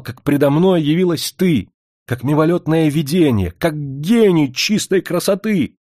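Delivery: outraged, then grandly declaiming; indignant shouting. A narrator's reading. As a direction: berate her with wounded pride. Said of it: "закричал на неё".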